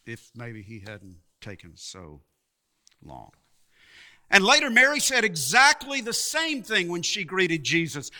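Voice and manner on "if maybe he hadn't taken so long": very low, near-inaudible murmur; hushed reproach. Acted. Preacher voices the sisters' unspoken thought about Jesus's delay, hushed reproach.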